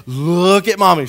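loud, high mom voice; imitated mother's forceful call; mom demanding attention. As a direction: repeat it insistently to an inattentive child. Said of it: "She would do that".

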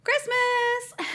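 high, drawn-out mock cheer of Christmas; retold delirious shout. Sick student announcing to roommates, mock delirious shout. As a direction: imitate a giddy, high-pitched festive cry. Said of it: "put my hands above my head and just shouted".

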